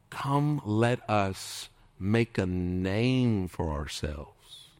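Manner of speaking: "name" drawn out and stressed, each word slowed right down; very slow, weighty reading. Shown as a demonstration. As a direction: linger on "name" to expose the pride. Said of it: "Look at that verse".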